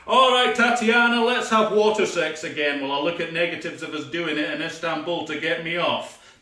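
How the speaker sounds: usual speaking voice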